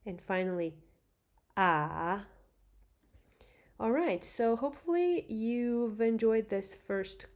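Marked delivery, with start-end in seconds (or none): 1.6-2.3 "ah" dips down then climbs back up; rising tone ah. Shown as a demonstration. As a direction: let "ah" sink then rise. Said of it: "you go down and then back up".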